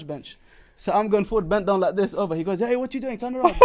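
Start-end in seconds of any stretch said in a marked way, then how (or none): 2.6-3.5 high, loud call to turn around; mock shout. Masseur calling out to him to turn around. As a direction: bark it like an annoyed masseur.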